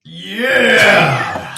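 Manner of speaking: long high-pitched yell; excited shout. Acted. Player's character answering the offer to wait, excited shout. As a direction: cry it out, loud and high.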